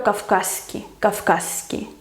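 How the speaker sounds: "kafkaski" with a lengthened hissed s; long S. Shown as a demonstration. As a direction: hold the s in "kafkaski". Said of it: "una S larga".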